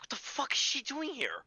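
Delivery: high, loud startled curse; retelling seeing the girl downstairs, shocked outcry; retold shocked outburst. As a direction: replay the jolt of seeing her there.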